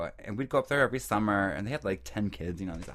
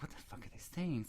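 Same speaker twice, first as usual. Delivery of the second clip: soft, higher-pitched bewildered aside; baffled muttering; 5 semitones higher, 11 dB quieter than usual. Retold or imitated. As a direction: quietly puzzle it out to yourself. Retells own baffled inner thought.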